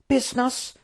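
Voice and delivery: high-pitched, appalled exclamation; indignant cry. Acted. Prince appalled by the Trook, indignant cry. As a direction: throw the word back in shock. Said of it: "cried the prince".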